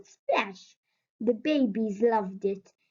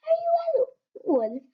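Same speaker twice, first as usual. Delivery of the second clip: put-on very high, curious young voice; wolf cub voice. A narrator's reading. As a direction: ask like a small cub.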